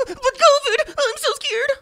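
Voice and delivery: very high, whiny, hammed-up fear; mock scared whimper. Host mocking frightened Democrats, hammed-up very high whimper. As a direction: squeak it like a mock-terrified coward. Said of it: "Democrats are gonna start crying and shaking".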